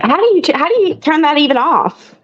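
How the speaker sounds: high-pitched puzzled question; baffled surprise. Real, not acted. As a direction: ask it in high, baffled disbelief. Surprised at a missing setting.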